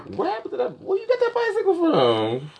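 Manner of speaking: high-pitched teasing disbelief; mock surprise. Real, not acted. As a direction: act shocked at the bike. Teasing a passerby about bike.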